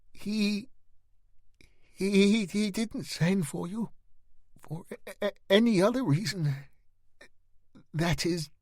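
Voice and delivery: high, stammering panic; strained stammer. Acted. Boy caught in a lie, stammering in fear. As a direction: trip over every word in fear. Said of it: "Gareth swallowed, his pale face suddenly very strained".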